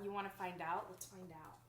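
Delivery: ordinary delivery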